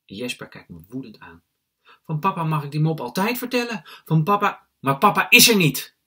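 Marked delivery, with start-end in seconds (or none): 2.0-4.7 put-on high, loud childish protest; angry little brother. Angry little brother argues back. 4.8-6.0 high, forceful shout; too-loud outburst. A narrator's reading. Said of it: "Ik zeg het net te hard".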